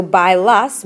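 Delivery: bailas drawn out slowly, syllable by syllable; slow demo of bailas. Shown as a demonstration. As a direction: stretch out bailas so students hear each sound. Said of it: "ends in A S".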